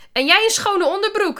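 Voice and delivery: high, loud comeback; high-pitched yelled taunt; shouted retort. A narrator's reading. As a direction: shout it back across the classroom. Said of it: "riep Miranda".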